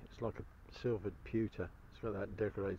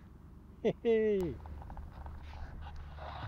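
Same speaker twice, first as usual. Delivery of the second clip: breathy laugh; 11 semitones higher than usual. Real, not acted. Delighted at a deep find.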